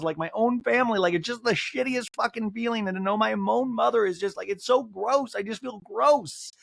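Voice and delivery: high, plaintive impression; mock upset venting. Castmate feeling unwanted by her family, mock upset. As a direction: ramble high as if distraught.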